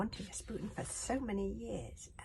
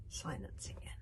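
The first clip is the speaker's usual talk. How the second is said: ordinary delivery, nothing marked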